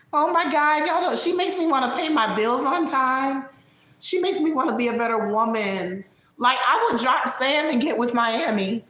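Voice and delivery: high, gushing caricature; high dramatic impression of a friend; Vegas. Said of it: "She sounds like a character".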